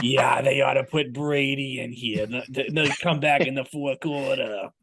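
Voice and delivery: put-on slow, thick Massachusetts accent; a Boston fan's Massachusetts accent. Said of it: "with a thick Massachusetts accent being like".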